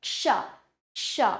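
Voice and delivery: क्षा with a light k into sh; light k plus sh. Shown as a demonstration. Teacher showing how क and श combine, light k. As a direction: say क्षा as a slight k blended into sha. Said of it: "हल्का सा का का और श का उच्चारण होता है".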